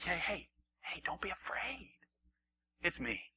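soft, quiet, reassuring; calm them down softly; gentle hush. Acted. Jesus comforting disciples.

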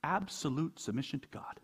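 hushed repeat, "Absolute" stressed and falling; quiet echo. Shown as a demonstration. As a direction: drop the voice and let it sink in. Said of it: "You hear that?".

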